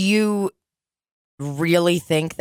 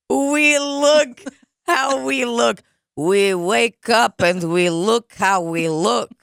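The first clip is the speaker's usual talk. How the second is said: put-on Russian accent; 6 semitones higher, slower than usual. Mock Russian hair motto.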